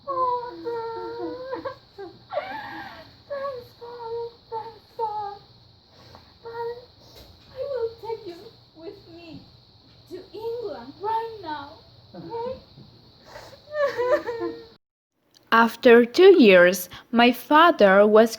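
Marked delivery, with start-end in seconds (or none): 0.0-12.5 high and much softer, trembling call; soft, high tearful calling to a lost father; tearful plea. Acted. Daughter relieved, takes father home. 13.7-14.5 tearful laugh. Acted. Daughter's tearful relief.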